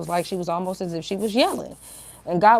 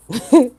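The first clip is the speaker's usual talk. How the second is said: quick, higher burst of laughter; short laugh; 5 semitones higher, 9 dB louder than usual. Real, not acted. Woman amused by her own pest control story, short laugh. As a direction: let a short, loud chuckle slip out. Amused aside about spraying.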